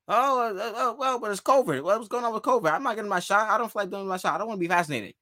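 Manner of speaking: mock excuse-making. Host mimics a player balking at the covid shot, mock excuse-making.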